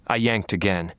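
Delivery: plain reading voice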